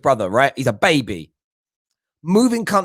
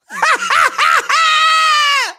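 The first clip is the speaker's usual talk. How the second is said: high, loud scornful laugh; loud mocking laugh; 5 dB louder than usual. Real, not acted. Host laughing at the oppression claim, loud and high. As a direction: burst out in a derisive cackle.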